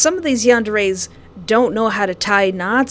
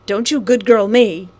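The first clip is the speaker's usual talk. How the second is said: louder, punchy comeback; hammed-up outraged telling-off; angry retort; 7 dB louder than usual. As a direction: bite back at him hard.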